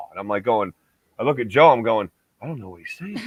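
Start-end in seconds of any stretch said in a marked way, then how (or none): 2.4-3.2 much softer, puzzled aside; hushed aside. Retold or imitated. Host turns to his buddy [name] for help, hushed puzzled aside. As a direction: drop the voice right down, baffled.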